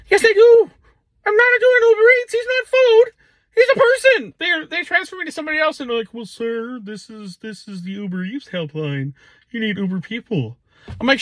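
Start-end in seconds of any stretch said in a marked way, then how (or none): 0.1-4.3 higher, louder, exasperated; exasperated outburst. Retold or imitated. Driver snaps at helpline agent, higher and louder, exasperated. 6.1-10.6 deadpan call-centre agent. Retold or imitated. Mocking second helpline agent.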